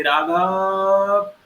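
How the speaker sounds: Raghav called out, the final आ stretched very long; calling from afar. Shown as a demonstration. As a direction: hail a friend far away, drawing out Raghav. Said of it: "जोर से चिल्लाएंगे और बुलाएंगे". (दूर से पुकारते हुए राघव)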